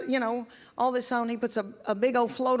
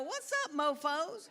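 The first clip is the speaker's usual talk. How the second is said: put-on sassy kid greeting; 5 semitones higher, 8 dB quieter than usual. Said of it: "she come in a room and go".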